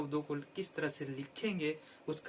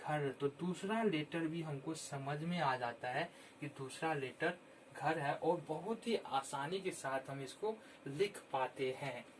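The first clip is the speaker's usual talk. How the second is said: normal talking voice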